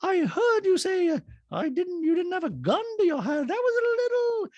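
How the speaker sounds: very high mocking impression, starting loud; shrieky falsetto, stretched out; hammed-up high-pitched whine; Dorit. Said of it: "And Dorit goes".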